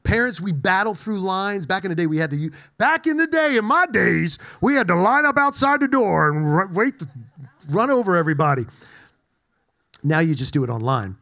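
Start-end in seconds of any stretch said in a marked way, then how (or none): 2.8-8.7 put-on old-timer voice. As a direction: ham up the grizzled veteran of toy lines. Parents battling lines at store.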